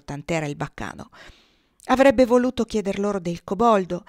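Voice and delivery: ordinary delivery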